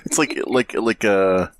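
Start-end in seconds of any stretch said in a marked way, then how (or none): none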